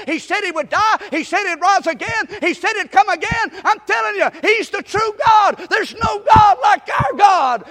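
usual speaking voice

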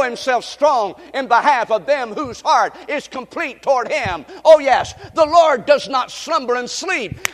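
emphatic, drawn-out preacher's delivery; fervent preaching. Acted. Preaches against a blind God.

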